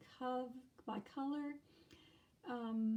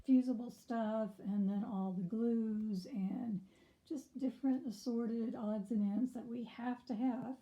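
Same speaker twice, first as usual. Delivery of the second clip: ordinary talk, nothing marked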